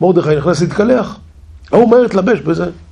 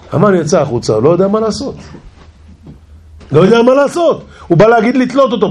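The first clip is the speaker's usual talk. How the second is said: ordinary talk, nothing marked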